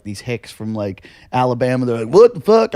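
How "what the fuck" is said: shocked yell. Retold or imitated. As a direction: blurt it in alarm. Hicks at a burning car.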